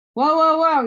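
sudden loud, high whoa to stop him; startled loud halt. A narrator's reading. Teacher stops kissing of hand.

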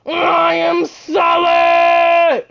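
slow stretched boast, shouted; drawn-out hype shout. Real, not acted. Streamer hyping his own defense, stretched shout. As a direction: drag every word out like a victory chant.

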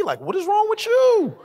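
high-pitched mock exasperation; exasperated disbelief. Dad baffled by his wide-awake daughter, exasperated. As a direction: climb high in comic bafflement.

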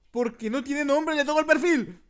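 high, loud yell of hammed-up panic; alarmed shout. Gamer reacting to a nameless profile, alarmed shouting. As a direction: shriek it in mock alarm.

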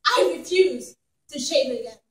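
usual speaking voice

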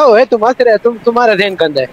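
normal talking voice

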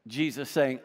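usual speaking voice

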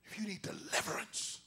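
low, breathy altar-call whisper; hushed whispered plea to step out. Real, not acted. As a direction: whisper the invitation.

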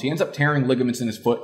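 usual speaking voice

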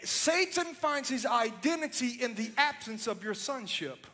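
climbing sermon fervor, shouting to the crowd; preaching at full force. Acted. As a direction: preach it like a revival altar call. Preaching builds over music.

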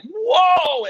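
high, loud amazed whoa; mock amazed whoa. Crowd reacting to the giant bullfrog, loud high whoa. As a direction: play the wowed crowd. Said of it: "people are like".

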